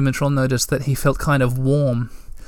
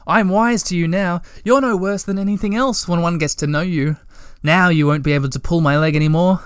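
higher, playful boast; cheerful raised voice, unafraid now; happy; 5 semitones higher than usual. A narrator's reading. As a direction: gloat merrily at the winter. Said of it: "he thought happily".

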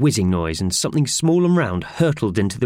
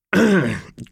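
awkward throat clear; throat clearing cough; 10 semitones higher, 3 dB louder than usual. Acted. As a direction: cough to change the subject. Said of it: "coughed Howard".

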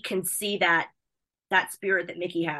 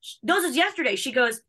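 usual speaking voice

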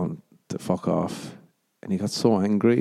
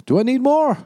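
very high, louder rhetorical squeal; mock questioning; 6 dB louder than usual. Shopper questioning himself over cardigans, high mock disbelief. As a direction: ask yourself in comic disbelief, pitched way up.

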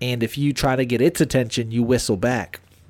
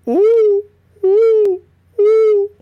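high, loud owl calls; imitated owl hoot; 7 dB louder, much slower than usual. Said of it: "I'm going like this".

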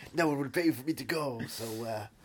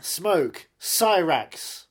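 loud, higher roll-call of fighters; announcing names; 4 semitones higher, 10 dB louder, much slower than usual. Acted. Naming game characters for the host, announced loudly. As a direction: punch out each name like an announcer.

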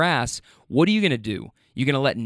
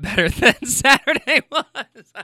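higher voice breaking into laughter; laughing while talking; 10 semitones higher than usual. Real, not acted. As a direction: laugh your way through the relief. Laughs recalling the better next day.

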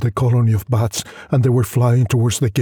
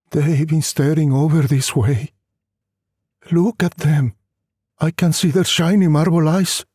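lightly raised, a hint of a tired wife; wife's voice; 6 semitones higher than usual. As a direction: voice the wife a little higher, resigned. Wife answers from the chapel.

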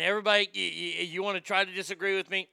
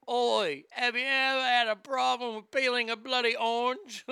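higher-pitched British infomercial voice; mock British pitchman; 5 semitones higher than usual. Harry selling an orange peeler on TV, mock British pitch. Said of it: "I'd love to see Harry and Meghan do infomercials".

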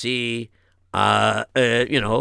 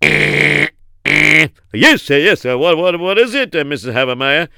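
loud stammered intercom answer; flustered boss; 3 semitones higher, 7 dB louder, slower than usual. Acted. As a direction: answer the secretary in a busy stammer. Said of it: "that's not, um, you know, uh, Geltmacher's voice".

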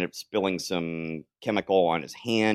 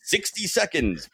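ordinary talk

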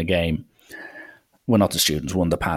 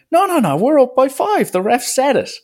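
high, insistent imitation of arguing players; retold high-voiced counter-argument; mock protest; 4 dB louder than usual. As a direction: fire back in a raised pitch. Players argue back about score.

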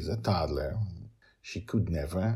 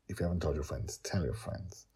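whispered plea; 10 dB quieter than usual. Real, not acted. Host asking listeners to share, soft whisper. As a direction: murmur it like a secret.

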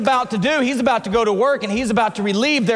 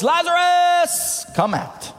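long, high, drawn-out call; summoning cry, stretched on the last syllable; loud calling voice; 10 semitones higher, slower than usual. Acted. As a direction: call the name out as if into a tomb. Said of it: "called in a loud voice".

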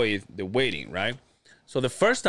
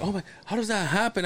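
higher, startled clerk; mock surprised clerk; 7 semitones higher than usual. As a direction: act baffled like the clerk. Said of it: "he's like".

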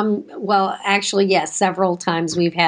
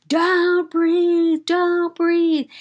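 put-on child yelling; 9 semitones higher, much slower than usual. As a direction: shriek it like a six-year-old in a meltdown. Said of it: "starts yelling at the top of his lungs".

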